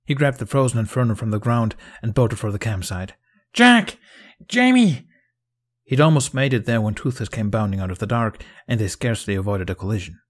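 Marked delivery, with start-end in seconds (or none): 3.5-5.1 loud, high shout for friends; urgent high-pitched holler; calling out. A narrator's reading. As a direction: yell their names across the campsite. Running to camp, calling friends.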